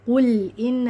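"قل ان" run together as "ul in"; qaf dropped. Shown as a demonstration. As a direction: mimic the reader losing q in "قل ان". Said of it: "كده يقول ايه؟".

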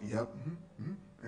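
slow, soft, lazy uh-huhs; mock a bored candidate; bored mumble. Coach mocks a checked-out candidate, slow bored murmur. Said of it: "You just sat there like this".

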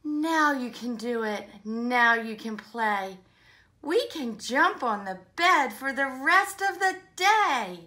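loud, punchy picture-book dialogue; animated story reading. Acted. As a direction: act out pleading and refusal, peaking high on the demand. Playmates argue in the story.

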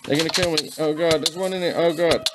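ordinary talk, nothing marked

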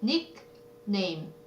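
"nickname" softly split into nick, pause, name; syllable by syllable. Shown as a demonstration. Teacher answering a vocabulary question, "nickname" in separate pieces. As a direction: sound out the two halves of "nickname". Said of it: "이거 붙여서 써야 돼요".

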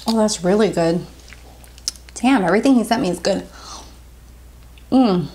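speaking while tasting candy; mouth partly full. Real, not acted. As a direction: finger at lips licking. Seen 2: Savoring candy, chewing, finger at lips from 3.1s.

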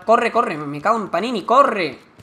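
being chased. Real, not acted. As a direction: flee for your life, yelling. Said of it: "a punto me pilla".

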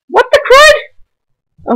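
very high, slow squeak; squeaky surprised voice. Real, not acted. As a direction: squeal it out in shock. Startled by caveman enemy.

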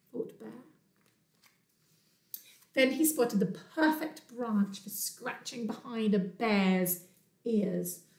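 plain reading voice

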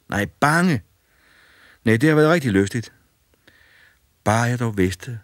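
lighthearted scoff at being scared; cheerful boy. A narrator's reading.

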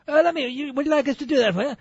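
high, quick mock English accent; English tourist. English couple offering to take a photo, mock English accent. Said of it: "the English couple behind us".

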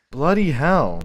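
loud exasperated bloody hell; frustrated curse. Real, not acted. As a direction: curse out loud after dying. Dies in game, exclaims.

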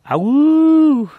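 high loud wolf howl. Pretend. Howls after the review.

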